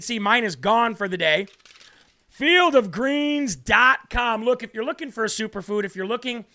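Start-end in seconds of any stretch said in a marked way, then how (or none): 2.3-4.4 slow drawn-out ad read. Acted. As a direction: stretch out the web address like a commercial.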